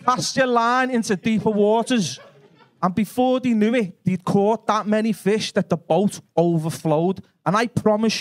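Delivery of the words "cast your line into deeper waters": Scouse Jesus. Acted. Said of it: "And he went".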